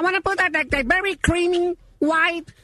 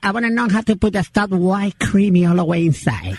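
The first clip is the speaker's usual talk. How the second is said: put-on much lower old man, loud and gruff; old father voice; 6 semitones lower, 3 dB louder than usual. Prank caller as the father complaining about his son, low gruff voice. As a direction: growl it like a grumpy old dad. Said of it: "my father here is with me".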